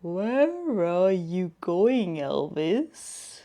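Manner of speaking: slow, muffled caterpillar voice. Acted. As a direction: speak as if through a mouthful. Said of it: "said with a muffled voice".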